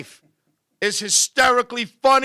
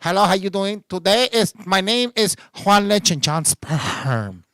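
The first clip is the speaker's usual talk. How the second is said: lower, halting caller with muddled English; Spanish-speaking caller; 4 semitones lower than usual. Acted. Spanish-speaking caller phoning customer service, low halting introduction. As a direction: play a caller struggling to introduce himself.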